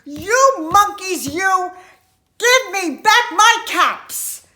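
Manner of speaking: slow, loud, high furious demand; exasperated storybook yell; upset shout. Acted. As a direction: shout each word at the monkeys. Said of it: "and he shouted".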